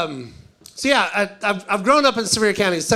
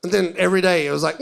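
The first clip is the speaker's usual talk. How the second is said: ordinary delivery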